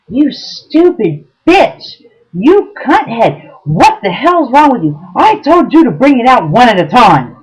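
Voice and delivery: high, loud, drawn-out furious yell at the waitress; retold screaming. Date screaming at the waitress over the food, loud furious yell. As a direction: scream it like a raging man in a restaurant. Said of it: "and he is screaming".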